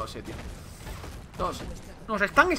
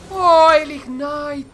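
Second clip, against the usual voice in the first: drawn-out excited gasp; 7 semitones higher, 9 dB louder, much slower than usual. Real, not acted. Reacting to ignite in fight.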